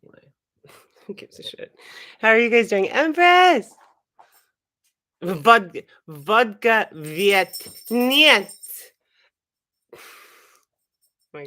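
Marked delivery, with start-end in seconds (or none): 2.9-3.7 high, loud shout of a name; loud excited greeting; calling out. Real, not acted. Calls out a greeting. 5.2-8.5 loud, slow Russian put-on; exaggerated Russian accent; mock Russian accent. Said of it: "do the Russian accent".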